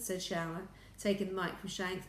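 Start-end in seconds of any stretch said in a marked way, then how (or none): none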